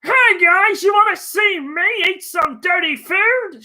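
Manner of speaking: chirpy fake host squeal; mock James Corden. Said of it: "It's gonna be James Corden".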